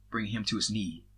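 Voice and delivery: plain reading voice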